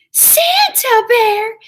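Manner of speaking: loud, slow, thrilled shout of the name; excited cry. Acted. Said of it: "she cried".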